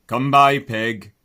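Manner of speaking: called command. Acted. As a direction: call the command across the paddock. Hoggett's command.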